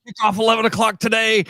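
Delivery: mock amped-up host, high and fast; excited imitation. Co-host teasing host's kickoff slip, excited imitation. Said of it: "that's just you being excited".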